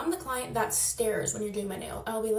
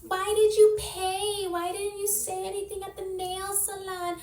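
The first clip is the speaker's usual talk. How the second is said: mocking critics; 9 semitones higher than usual. Viewers scolding her for paying, mimicked in a high whiny voice. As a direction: imitate the nagging critics, pitched up. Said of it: "everyone's gonna be like".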